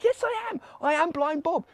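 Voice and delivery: very high, eager confirmation; excited insistence. Acted. Healed beggar assures the doubting crowd, excitedly.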